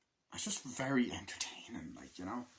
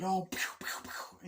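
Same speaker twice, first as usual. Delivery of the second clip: gunfire noises; 9 semitones higher, slower than usual. Retold or imitated.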